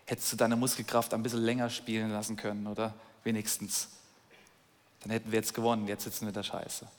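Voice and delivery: soft, muttered sarcastic complaint; an Israelite soldier to the king: soft sarcastic grumbling; sarcastic grumble. Pretend. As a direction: grumble under your breath like a let-down soldier. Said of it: "dann denkst du".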